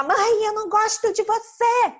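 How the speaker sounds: high, loud acted kid tantrum; angry child. Angry child to mother, high loud outburst. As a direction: throw it at mum like a cross child. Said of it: "a criança fica com raiva e fala".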